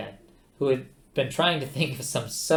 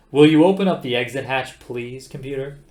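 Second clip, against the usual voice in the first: restrained anger; 11 dB louder than usual. Acted. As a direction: hold the anger down. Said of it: "trying not to get angry".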